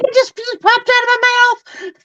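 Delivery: chipmunk voice. Retold or imitated. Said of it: "I'm like".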